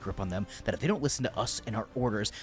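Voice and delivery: usual speaking voice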